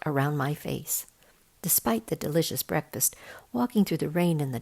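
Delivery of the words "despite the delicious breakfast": plain reading voice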